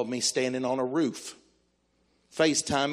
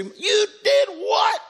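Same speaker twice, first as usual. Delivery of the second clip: high, drawn-out mock outrage; outraged disbelief; 4 dB louder, much slower than usual. Wife learns the grocery money is gone, acted outrage. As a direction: wail it in shocked disbelief, stretching each word. Wife's shocked reaction, acted.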